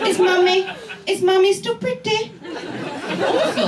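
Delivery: high, coy posh mother angling for a compliment; posh flirty mum.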